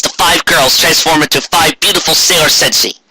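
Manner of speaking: usual speaking voice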